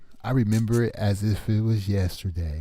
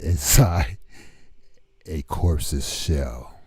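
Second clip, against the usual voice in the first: slow, dramatic spoken-word reading; poem recitation; slower than usual. Pretend. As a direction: recite it slowly like a horror poem.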